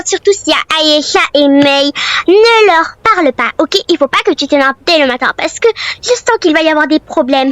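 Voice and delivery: usual speaking voice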